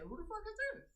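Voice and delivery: high, disbelieving question with a rise; incredulous inner voice. Retold or imitated. Streamer voicing her thought in her head, high and disbelieving. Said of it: "I say it in my head".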